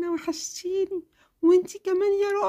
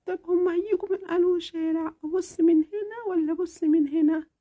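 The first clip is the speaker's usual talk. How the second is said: put-on impatient little girl. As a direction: fret like a kid who can't wait. Child eager looking.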